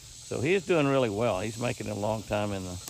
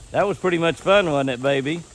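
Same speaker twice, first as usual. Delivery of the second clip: talking to an animal; 6 semitones higher, 7 dB louder than usual. Real, not acted. Cooing at deer.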